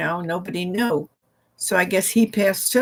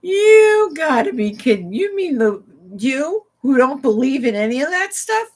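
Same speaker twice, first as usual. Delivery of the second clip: higher, louder astonished outburst; shocked disbelief; 5 semitones higher, 7 dB louder than usual. Retold or imitated. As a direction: gasp out your disbelief at him. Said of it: "I was like".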